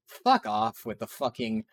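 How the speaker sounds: ordinary delivery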